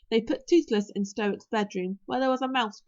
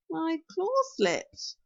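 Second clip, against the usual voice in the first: slow, higher-pitched whining excuse; whine; 6 semitones higher, much slower than usual. A narrator's reading. Hook Fang making excuses to Stoic, whining. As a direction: whine it slowly like a guilty dragon. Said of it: "whined Hook Fang".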